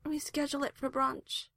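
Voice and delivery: high-pitched, yawny plea; sleepy pleading. A narrator's reading. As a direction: beg drowsily for a later start. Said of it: "pleadingly at Varric".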